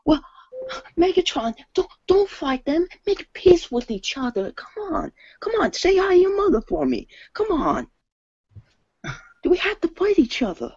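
high, pleading impression; Mark Wahlberg. Actor talking Megatron out of fighting, high pleading voice. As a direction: coax in a very high put-on voice. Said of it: "Mark Wahlberg trying to convince Mega".

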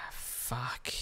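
breathy whispered swear under the breath; a sad, whispered curse at the screen. Real, not acted. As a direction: whisper the curse to yourself, deflated. Quiet curse at a sad scene.

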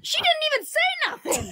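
shouting through laughter; laughing shout. Real, not acted. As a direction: laugh it out loudly. Laughing defence of friend.

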